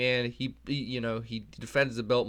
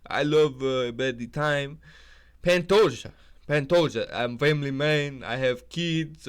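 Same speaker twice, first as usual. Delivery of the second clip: mock Pantoja; 3 semitones higher, 4 dB louder than usual. Imitating Pantoja introducing himself, slow put-on accent. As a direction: lay the accent on slowly.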